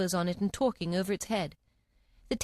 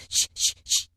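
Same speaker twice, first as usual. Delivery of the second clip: loud hushing; shushing; 4 dB louder than usual. A narrator's reading. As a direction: hush her sharply.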